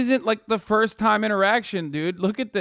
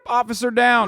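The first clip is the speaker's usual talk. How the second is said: louder, higher officer-down call; radio call; 5 semitones higher, 3 dB louder than usual. Pretend. Mock police radio call.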